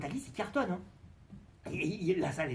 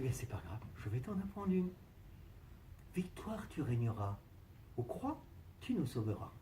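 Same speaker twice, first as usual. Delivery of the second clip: lower, softer teaching the hymn; soft divine voice; 5 semitones lower, 11 dB quieter than usual. Acted. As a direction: hum it gently like God. God teaches the hymn softly.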